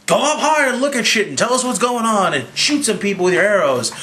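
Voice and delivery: lightly voiced Captain giving orders; bossy Captain America. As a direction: hand out jobs like a mock commander. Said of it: "Captain America's like".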